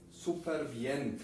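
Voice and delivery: normal talking voice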